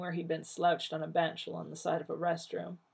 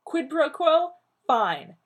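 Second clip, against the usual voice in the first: angry; 10 semitones higher, 9 dB louder, slower than usual. Acted. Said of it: "looking murderous".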